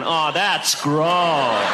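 drawn-out, disgusted groaning of the words; comic fish voice, dragging out its disgust. Acted. Fish swimming round a naked swimmer, grossed out.

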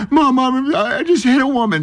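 put-on high, teary cry of a scared boy; boy runs crying to his mom, imitated high and teary; tearful child. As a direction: gasp it out to mom in mock tears. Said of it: "I'm in tears".

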